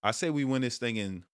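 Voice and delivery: ordinary talk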